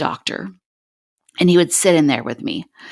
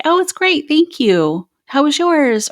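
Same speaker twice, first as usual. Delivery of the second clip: bright, much higher, overly cheerful politeness; fake happy tone; 11 semitones higher, 4 dB louder, slower than usual. Wife to bagger, imitating her own fake happy tone. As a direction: chirp it like a forced-polite cashier chat. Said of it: "See that happy fake tone".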